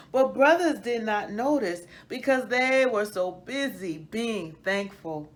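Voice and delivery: put-on lively storybook narration for children; story reading. A narrator's reading. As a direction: read it like a bedtime picture book to kids.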